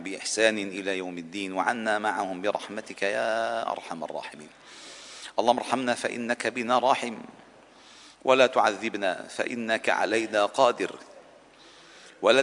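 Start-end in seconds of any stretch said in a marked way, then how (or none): none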